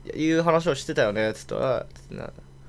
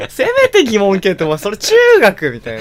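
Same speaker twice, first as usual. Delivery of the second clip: normal talking voice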